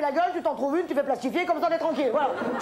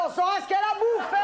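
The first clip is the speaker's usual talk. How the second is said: exasperated shout; 5 semitones higher, faster than usual. Acted. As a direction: yell it out, fed up and high. Waiter yelling at rude customer.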